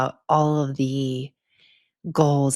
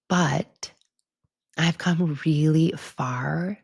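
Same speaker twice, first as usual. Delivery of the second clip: slow whispered admission; much slower than usual. Real, not acted. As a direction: say it slowly, almost to yourself. Quiet proud admission.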